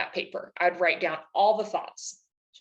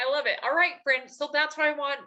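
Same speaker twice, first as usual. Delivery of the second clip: ordinary delivery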